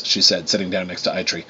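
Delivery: ordinary delivery, nothing marked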